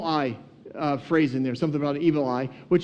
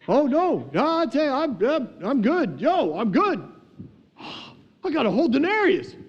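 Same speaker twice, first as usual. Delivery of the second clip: high, swooping giddy excitement with a gasp; hammed-up thrilled babble, pitched way up; 8 semitones higher than usual. First worker paid a full day's wage, acting overjoyed. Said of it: "he was not quiet about this".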